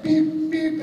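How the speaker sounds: high beep tones. Retold or imitated. Mimicking the two beeps before his line. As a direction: imitate two cue beeps, high and short. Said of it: "it was like".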